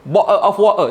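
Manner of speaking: mock British English with dropped t's; British accent. Shown as a demonstration. Speaker mocking needlessly fancy English, in a British accent. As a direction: put on an exaggerated British accent. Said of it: "Macam British punya accent".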